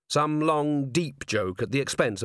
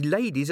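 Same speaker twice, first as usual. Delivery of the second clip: "ladies" said with relish and lingered on; savoured word; 4 semitones higher than usual. A narrator's reading. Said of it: "Cherie savoured the word ladies".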